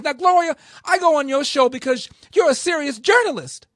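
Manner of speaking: hammed-up flustered politician dodging the question; shrill squeaky stammering caricature; mocking Maxine Waters. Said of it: "And she said".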